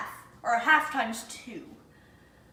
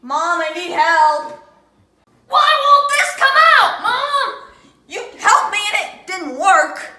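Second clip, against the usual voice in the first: loud, high, whiny plea to mom; calling for help; 8 semitones higher, 13 dB louder than usual. Real, not acted. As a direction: yell for mom, frustrated and laughing.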